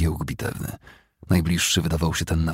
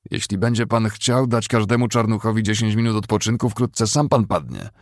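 wary worker; 5 semitones higher, 3 dB louder than usual. A narrator's reading. Worker to his commander, mildly defiant.

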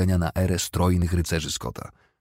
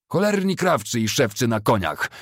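higher-pitched cursing shout; moderately raised voice, angry jeer; indignant call; 5 semitones higher than usual. A narrator's reading. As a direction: curse them aloud while riding past. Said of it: "zawołał któryś".

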